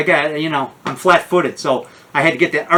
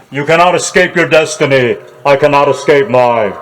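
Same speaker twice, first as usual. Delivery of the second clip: Dracula voice through echo mic; toy echo microphone; 3 semitones lower, 3 dB louder than usual. Acted. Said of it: "here's my Echo mic".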